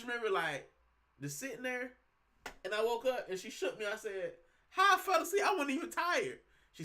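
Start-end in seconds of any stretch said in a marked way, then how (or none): 4.7-6.4 much higher and louder, baffled; retelling his own shock at waking; bewildered. Retold or imitated. Himself waking up to his wife, bewildered.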